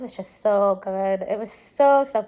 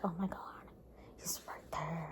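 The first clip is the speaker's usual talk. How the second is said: hushed, breathless whisper; awed whisper; 4 semitones lower, 16 dB quieter than usual. Retold or imitated. Fan whispering as Logan comes near, awed whisper. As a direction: barely voice it, starstruck.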